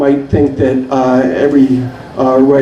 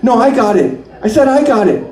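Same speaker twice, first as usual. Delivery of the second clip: rising calls, the last one pitched high; calling out; 6 semitones higher than usual. Acted. Bar poets calling for the fly ball, rising insistence. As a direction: call for the ball, each call more insistent. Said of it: "call out".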